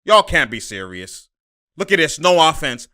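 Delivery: ordinary talk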